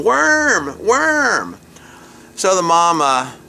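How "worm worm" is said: high, drawn-out mimicked toddler wail; screaming toddler. Storyteller as little Willie yanking the hose, high mock wail. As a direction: imitate a small boy crying out high. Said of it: "He's going".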